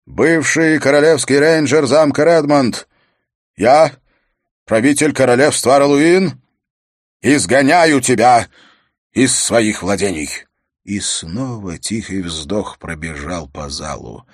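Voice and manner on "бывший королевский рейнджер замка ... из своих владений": loud, ceremonial royal decree; raised, weighty pronouncement with pauses; stern king passing sentence. Acted. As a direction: proclaim it to the hall, phrase by phrase.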